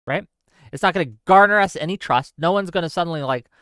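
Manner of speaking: ordinary talk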